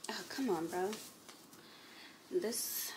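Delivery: soft breathy whisper. Real, not acted. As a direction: whisper the word. Unvoiced whisper.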